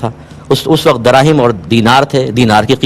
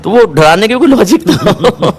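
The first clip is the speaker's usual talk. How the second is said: higher-pitched, breaking into a laugh; amused laughing; 6 semitones higher than usual. Real, not acted. Scholar scoffing at a lice superstition, amused laughing. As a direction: laugh through the words at a silly idea.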